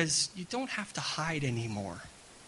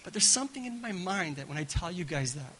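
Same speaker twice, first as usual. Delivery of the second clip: ordinary talk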